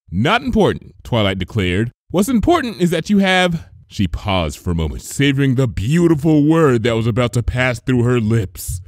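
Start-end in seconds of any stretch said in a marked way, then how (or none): 0.1-3.7 lightly higher, louder insistent mare; Twilight's voice. A narrator's reading. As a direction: brush it aside impatiently.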